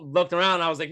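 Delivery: ordinary talk, nothing marked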